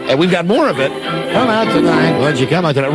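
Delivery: normal talking voice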